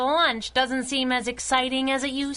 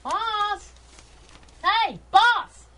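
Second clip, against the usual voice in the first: calling out; 6 semitones higher, much slower than usual. Acted. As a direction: hail the boss from across the house. Kit calling out for the boss.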